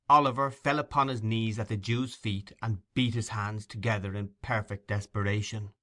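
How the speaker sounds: ordinary delivery, nothing marked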